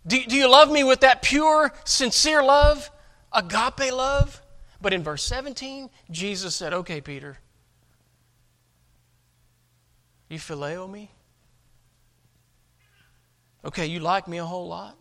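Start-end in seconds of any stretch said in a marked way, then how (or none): 0.1-4.4 raised, higher, drawn-out question; acted heartfelt plea, stretched at the end; earnest appeal. Acting Jesus's earnest question to Peter. 6.7-15.0 soft, halting, let-down question; acted quiet concession to Peter; resigned question. Acting Jesus lowering the question.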